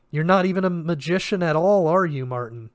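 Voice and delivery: ordinary delivery, nothing marked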